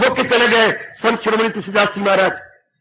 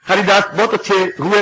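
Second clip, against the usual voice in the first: ordinary talk, nothing marked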